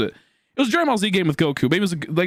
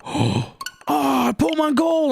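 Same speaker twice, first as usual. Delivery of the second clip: high, awed gasp at a present; mock-thrilled high exclamation; excited gasp; 10 semitones higher, slower than usual. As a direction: gasp and squeal over the gift, pitched up. Acts excited reaction to gift.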